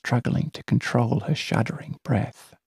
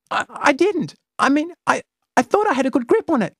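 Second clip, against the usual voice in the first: high, quick flustered stammer; 4 dB louder, faster than usual. Acted. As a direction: trip over your words. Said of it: "stammers her companion".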